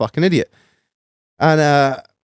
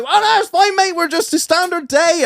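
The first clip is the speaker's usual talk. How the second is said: busy baker voice. Retold or imitated. Quoting Sean the baker.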